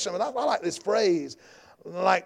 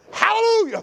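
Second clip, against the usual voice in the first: very high exclaimed hallelujah; jubilant praise; 13 semitones higher, 5 dB louder than usual. Real, not acted. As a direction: cry out praise from the pulpit. Exclaims praise at sermon's peak.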